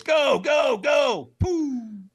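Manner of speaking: loud, high urgent go; coach's shout. Retold or imitated. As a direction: launch him with a yell. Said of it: "the trainer goes".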